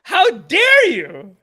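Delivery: slow, loud 'How dare you?' ending on a rise; mock outrage. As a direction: ask 'dare' like an offended question. Repeated mock outrage, rising.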